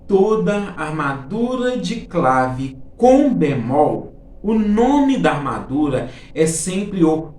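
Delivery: slow, deliberate, word-by-word emphasis; emphatic rule. Shown as a demonstration. Teacher asking students to pay close attention, slow and emphatic. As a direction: spell the rule out slowly and firmly. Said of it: "presta bem atenção no que eu vou falar".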